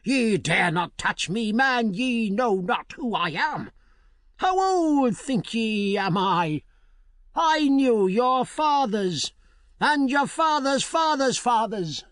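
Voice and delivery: put-on crowing old crone, climbing high; boasting witch. A narrator's reading. Witch boasts she knew their forefathers, high and proud.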